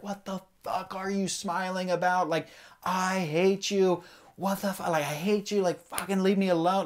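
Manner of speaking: high-pitched mock rage, strained and biting; retold furious screaming. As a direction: hurl it at God like you've finally snapped. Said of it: "and I was just screaming".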